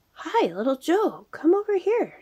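loud high call across the water; shouting. A narrator's reading. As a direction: call out loudly to a friend. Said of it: "shouted Jerry".

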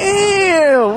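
high, long-held damn; drawn-out exclamation. Real, not acted. Filmer reacting at the street race, exclaiming. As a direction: let DAMN ring out high and stretched.

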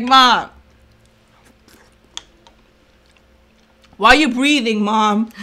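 annoyed daughter. Retold or imitated.

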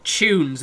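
"Tunes" said with a CH, like "choons"; British "choons" for "Tunes". Real, not acted. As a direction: open "Tunes" with a CH sound instead of T. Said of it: "C H all the way".

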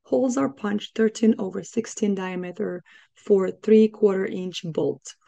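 usual speaking voice

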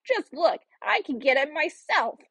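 high, swooping witch voice; impatient old woman. A narrator's reading. Witch showing Gretel the oven door, impatient. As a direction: show the silly girl, high and exasperated. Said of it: "said the old woman".

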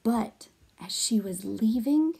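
hushed storytelling whisper; whispered suspense. Acted. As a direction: drop to a whisper as she leaves.

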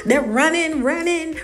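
normal talking voice

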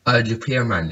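usual speaking voice

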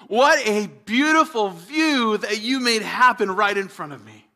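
loud, awed exclamations of wonder; exuberant spoken praise. Pretend. Preacher models out-loud praise to Jesus, loud and awed. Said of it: "to express out loud".

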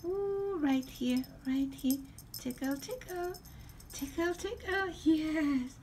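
high, cooing pet talk. Real, not acted. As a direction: coo at the cat while tickling. Playing with the cat.

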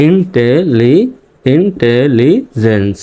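Intelligence slowly in rhythmic syllables, first only ইন্টেলি; built up by syllables. Shown as a demonstration. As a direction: build intelligence piece by piece. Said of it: "ছান্দ শিক্ষক তৈরি".

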